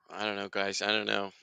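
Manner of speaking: low, soft, resigned to it; sigh, then let it sink low and quiet; dejected sigh. Real, not acted. Watchalong host despairing to viewers, low and dejected.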